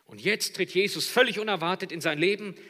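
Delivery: loud, high, soaring sermon voice; full-force preaching. Real, not acted. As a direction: build the scene with full preaching power. (predigen mit voller Kraft)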